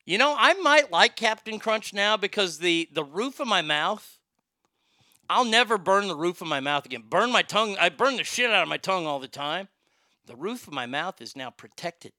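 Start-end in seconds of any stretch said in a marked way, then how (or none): none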